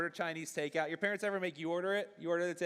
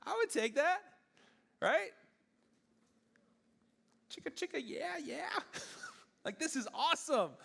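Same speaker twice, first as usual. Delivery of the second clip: high, giddy mock celebration; imitating a thrilled listener, squeaking high; mock excited; 9 semitones higher than usual. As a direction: play the kid cheering over a billion dollars. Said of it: "Some of y'all are like".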